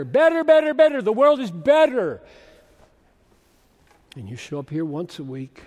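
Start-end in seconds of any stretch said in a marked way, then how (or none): 0.0-2.2 high, loud, insistent cry of better; shouting temptation. Acted. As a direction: let the world's sales pitch burst out. The world's voice climaxes.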